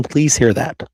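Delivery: normal talking voice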